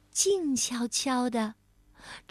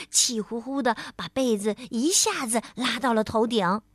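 usual speaking voice

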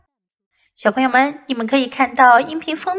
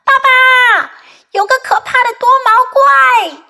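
high, loud shout for Dad; acted child's yell; 11 semitones higher, 5 dB louder than usual. A narrator's reading. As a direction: raise a mock alarm at full voice. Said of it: "他大喊道".

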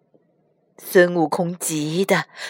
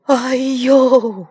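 cry of pain; 3 semitones higher than usual. Acted. Demon thrown off cliff.

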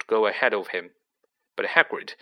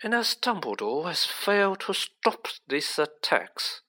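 higher-pitched silky villain; silky, superior official; smooth; 7 semitones higher than usual. A narrator's reading. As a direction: press the accusation smoothly. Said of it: "said Mister Malfoy smoothly".

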